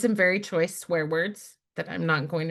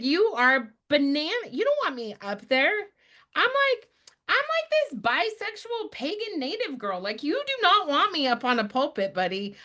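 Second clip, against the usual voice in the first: incredulous; 10 semitones higher than usual. Retold or imitated. Refusing priest's request in disbelief.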